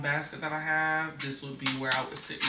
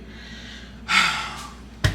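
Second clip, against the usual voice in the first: heavy sigh; 4 semitones higher than usual. Real, not acted. Exasperated mom telling kid story.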